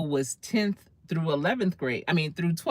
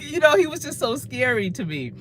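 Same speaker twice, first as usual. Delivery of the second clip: normal talking voice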